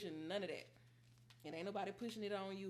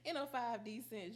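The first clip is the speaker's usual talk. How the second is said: laughing; 5 semitones higher, 5 dB louder than usual. Real, not acted. Laughing at own joke.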